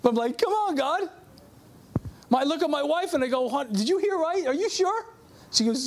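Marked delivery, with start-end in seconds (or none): 0.4-1.1 high-pitched exasperated plea, played for laughs; mock pleading. Retold pleading with God in disbelief. 3.5-5.1 higher-pitched doubtful questioning; mock disbelief. Retold doubting question to his wife.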